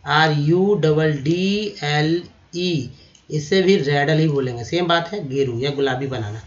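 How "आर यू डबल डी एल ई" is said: आर यू डबल डी एल ई letter by letter, slowly; slow spelling out. Shown as a demonstration. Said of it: "spelling रैडल का". (धीरे-धीरे अक्षर बोलें)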